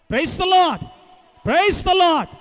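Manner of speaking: loud call to the crowd; rousing shout. Real, not acted. Preacher rousing a sleepy congregation, loud shouted call. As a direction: shake off the tiredness, shout it out. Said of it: "మనలో ఉన్న నీరసాన్ని విడిచిపెడదాం".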